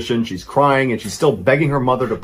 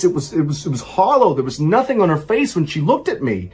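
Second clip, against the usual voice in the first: higher, shaken, breaking off; shaken horror; 5 semitones higher than usual. Retold or imitated. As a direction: stammer through the horror. Terrified mother reveals what she saw.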